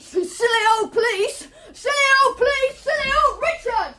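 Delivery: high, frantic, shouted taunt; a wild, crying, shouted chant; manic shout. Acted. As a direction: scream it out, gleefully unhinged. Boy's manic outburst.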